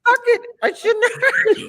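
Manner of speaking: laughing through a retold apology. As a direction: quote him while cracking up. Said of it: "he's like".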